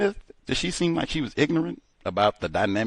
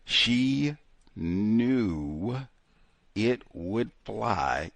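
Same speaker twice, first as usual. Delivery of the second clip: very slow, word by word; slowed right down; much slower than usual. Shown as a demonstration. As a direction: spell it out slowly with pauses between words. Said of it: "Slow down".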